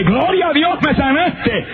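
joyful cry. Retold or imitated. The healed man cries out to God in jubilation. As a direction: burst out in thankful joy.

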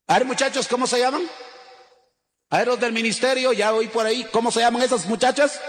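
más agudo y fuerte, preguntando; llamando al público. Real, not acted. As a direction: anima a los muchachos a responder. (el predicador llama a los muchachos del ministerio, en voz alta)

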